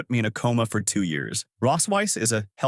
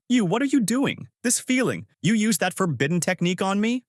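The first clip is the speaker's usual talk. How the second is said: scolding; 9 semitones higher than usual. A narrator's reading. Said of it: "Then I scolded out".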